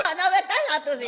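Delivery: loud, fast, playful scolding; loud teasing protest. Real, not acted.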